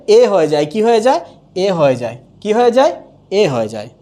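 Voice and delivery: normal talking voice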